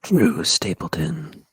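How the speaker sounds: close-mic whisper; right in ear. Shown as a demonstration. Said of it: "go really close and say something in a whisper".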